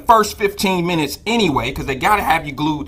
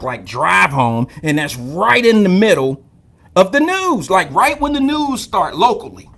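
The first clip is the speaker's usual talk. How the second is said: ordinary delivery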